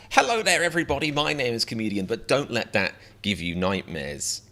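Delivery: a bright showman welcome; hammed-up host hello; cheery host.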